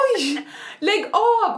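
high, loud disbelieving cry; a real yelp of surprise at the story; surprised outcry. Real, not acted. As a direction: burst out in amazed disbelief. Hearing a funny listener story.

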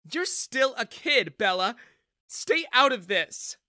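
mother rebuking her daughter; stern rebuke. A narrator's reading. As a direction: put the kid in her place.

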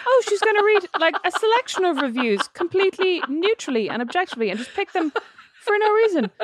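high, exaggerated naive voice; mock naive self. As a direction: play your own gullible self, way up high. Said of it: "I was like".

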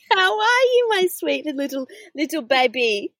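put-on baby talk.

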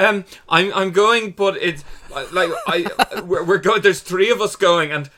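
high, loud, babbling nerves; flustered traveller. Retold or imitated. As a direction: stammer it out, panicking at the desk. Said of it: "And you're like".

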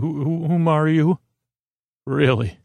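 ordinary talk, nothing marked

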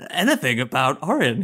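slow gloating villain voice; a light hammy evil genius; mad scientist. Retold or imitated. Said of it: "coming in being like".

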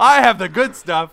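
high, loud comic protest; mock indignation. Stuck-at-home parent versus party idiots, mock indignant cry. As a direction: belt it out with playful outrage.

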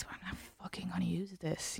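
unvoiced whispered aside; a sneaky muttered aside. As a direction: drop to a whisper for the rude part. Whispered aside mid-quote.

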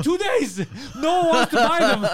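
very high, loud aggrieved shout; indignant yelling. Retold or imitated. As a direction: wail it in hammed-up outrage, voice way up. Acts out buddy's indignant answer.

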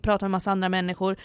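ordinary talk

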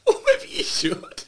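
high and slow laughing. Real, not acted. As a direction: say it through laughter. Laughing at joke.

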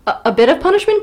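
high, loud questioning echo; disbelieving repeat; incredulous. Real, not acted.

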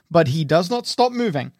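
ordinary talk

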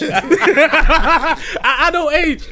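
slow, laughing through words. Real, not acted. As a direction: laugh it out slowly. Laughing at own idea.

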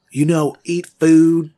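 slow, loud, over-simple words to a foreigner; slow loud pidgin. Acted. Doctor miming to a nurse, loud and slow. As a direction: spell it out loudly like she can't understand. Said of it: "And I start pointing at my face".

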